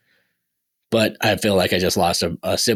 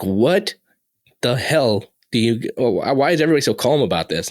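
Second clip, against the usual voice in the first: retold incredulous outburst, pitch raised; baffled disbelief; 4 semitones higher than usual. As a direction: drag out the first words, then question higher in mild disbelief. Retold bewilderment at calm household.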